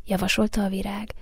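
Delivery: plain reading voice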